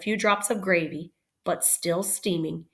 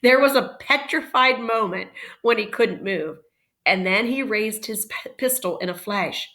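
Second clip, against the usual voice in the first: usual speaking voice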